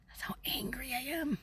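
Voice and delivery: hushed intense whisper; whispered fury. Real, not acted. Telling viewers about the broken holder, whispered. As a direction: whisper it through rage.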